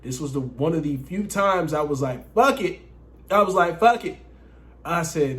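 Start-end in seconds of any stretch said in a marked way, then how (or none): 2.3-2.8 exclaimed high and loud; a sudden shout of fuck it; loud reckless fuck it. Retold or imitated. Deciding to go raw. 3.8-4.2 exclaimed high and loud; a sudden shout of fuck it; loud reckless fuck it. Retold or imitated. Deciding to go raw.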